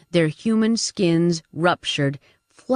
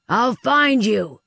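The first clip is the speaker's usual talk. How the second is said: yelled promise; 5 semitones higher, slower than usual. Acted. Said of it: "Curran yelled".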